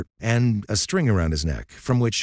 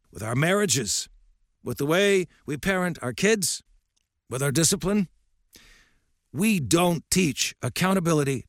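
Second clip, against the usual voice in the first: put-on lightly raised, gruff old coach lecturing; old coach's voice; 8 semitones higher than usual. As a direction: speak as a stern old baseball coach.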